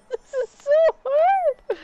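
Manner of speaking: high, loud, slow laugh-cry; laughing despair. Real, not acted. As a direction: laugh through real frustration.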